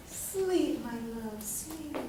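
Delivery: hushed, tender bedtime murmur; soothing lullaby voice. Acted. Teacher playing a kind spirit putting her to sleep, soothing. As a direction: fade softly as if lulling someone to sleep. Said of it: "that puts her to sleep?".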